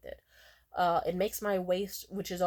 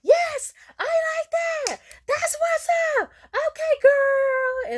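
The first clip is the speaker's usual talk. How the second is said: very high, loud cheering shouts; yelling hype girls; 10 dB louder, slower than usual. Retold or imitated. Girls in a passing car cheering her on, high and shouting. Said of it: "they just yelled".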